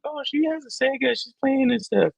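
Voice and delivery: very high put-on mother voice; imitated neighbor's mom.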